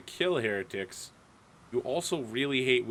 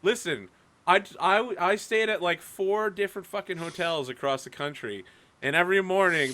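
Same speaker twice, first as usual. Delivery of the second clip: normal talking voice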